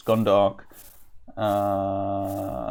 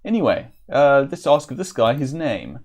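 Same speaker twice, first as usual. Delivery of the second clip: ordinary talk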